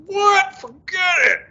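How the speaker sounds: high, loud disbelieving outburst; mock incredulous shout. Younger self hearing he'd be a mystic, loud high scoff. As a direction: blurt it out like the idea is absurd. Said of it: "I'd be like".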